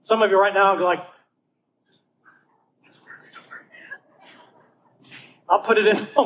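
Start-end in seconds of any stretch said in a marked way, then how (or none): 2.8-3.7 breathy aside to the crowd; whisper. Pretend. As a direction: secretly ask it under your breath. Mimics audience asking quietly.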